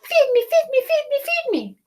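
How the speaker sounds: very high, loud begging chant; hungry duckling crying. Acted. Duckling begging Jack for food. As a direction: demand food. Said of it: "cried the hungry baby duck".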